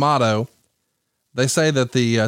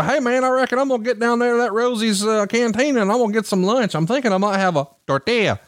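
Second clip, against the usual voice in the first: mock Southern twang, pitched up; Alabama accent; 8 semitones higher than usual. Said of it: "[name] has now adopted an Alabama accent".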